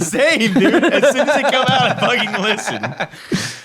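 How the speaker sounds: words spoken through laughter; high, giggling delivery; laughing while talking. Real, not acted. Joking that they forget everything.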